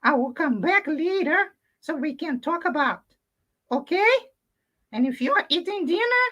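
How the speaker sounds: high puppet voice, upset about his hat then calming; Pedrito puppet voice. Acted. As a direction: play the grumpy little puppet who calms down. Puppet Pedrito talks to children.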